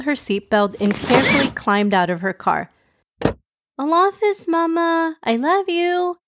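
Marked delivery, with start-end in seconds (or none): 3.8-6.2 put-on very high-pitched child voice; sweet high voice of a young girl; little girl's goodbye. Zainab saying goodbye to her mom, high child voice.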